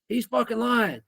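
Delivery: high-pitched mock outraged accusation; freaking out. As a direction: imitate an angry kid pointing the blame. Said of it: "starts freaking out".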